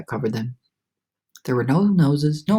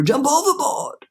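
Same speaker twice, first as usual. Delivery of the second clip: higher, urgent; panicked; 11 semitones higher than usual. A narrator's reading. Grasshopper urging escape, panicked. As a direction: cry out to flee.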